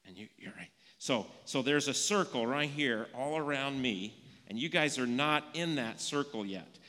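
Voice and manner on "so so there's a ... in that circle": teacher demonstration. Shown as a demonstration. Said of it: "demonstrating circle to children".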